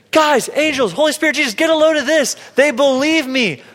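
high, excited call to heaven; a voice of God calling the angels over, thrilled; God voiced, delighted. God calls angels to witness our step, high and thrilled. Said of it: "he goes".

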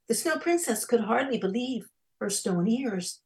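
ordinary delivery

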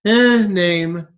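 so-so "eh" before NAME, then move on; dismissive "eh". Real, not acted. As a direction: shrug off the name with an "eh". Middling name set against big one.

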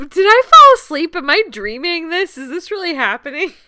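loud, high incredulous disbelief, half laughing; can this be real, laughing. Retold or imitated. To a friend, retelling a bizarre movie scene, incredulous. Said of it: "I was like".

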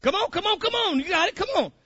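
high-pitched, quick urging; coaxing. Real, not acted. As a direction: egg them on in a high voice. Coaxing listeners to find the answer.

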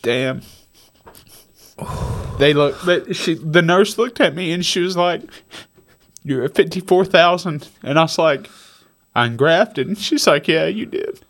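fighting tears, pitch climbing; choked up. Real, not acted. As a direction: voice high and breaking with emotion. Emotional climax retelling recovery.

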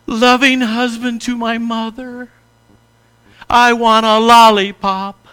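mock-grand child's flattery ending in a demand; kid buttering up dad. Shown as a demonstration. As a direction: lay it on thick like a wheedling kid. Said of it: "like a kid coming to his dad".

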